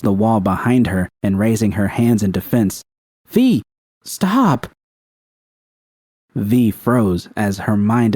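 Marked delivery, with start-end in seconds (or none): 3.3-4.7 high, sharp cry of fear; high panicked stop; frightened plea. Acted. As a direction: cry out to stop her, scared. Judy pleads in fear.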